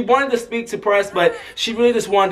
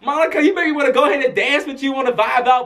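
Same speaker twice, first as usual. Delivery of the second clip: higher-pitched, eager mock invitation; starstruck gushing; 8 semitones higher than usual. Escort imagines asking singer to dance, mock starstruck gushing. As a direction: gush it in a lifted voice.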